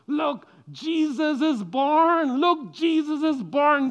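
high, loud, jubilant announcement; excited rejoicing. Acted. Shepherds tell everybody of the birth, rejoicing loudly. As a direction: call out the great news, slow and ringing. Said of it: "they were so excited, and they were rejoicing".

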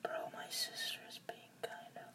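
hushed unvoiced whisper. Real, not acted. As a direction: whisper it to the viewers. Whispering side comment during ASMR.

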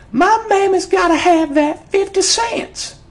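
put-on the girl standing her ground, shouting; a child's high-pitched defiant yell. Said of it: "the child yells".

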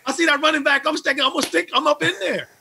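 amped. Real, not acted. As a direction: fire up like a linebacker. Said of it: "I know I'm amped about this stuff".

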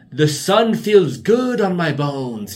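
put-on high, loud, slow creaky old man; old professor impression. Imitating mom's old boyfriend basking in the sun. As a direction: savour each word like an old philosopher. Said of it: "he'd be like".

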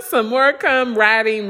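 usual speaking voice

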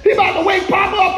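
normal talking voice

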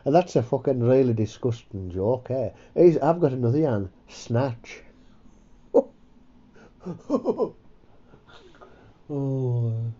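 normal talking voice

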